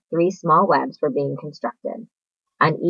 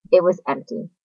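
plain reading voice

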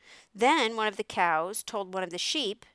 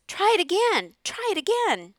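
very high, loud goose urging; goose crying out; 12 semitones higher, 7 dB louder than usual. A narrator's reading. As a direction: egg someone on with high-pitched honking calls. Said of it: "cried the goose".

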